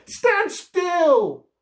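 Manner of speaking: high, loud breathless demand; playful shout. A narrator's reading. Little girl shouting at Robbie to stop dodging. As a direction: shout it mid-chase. Child chasing robot in game.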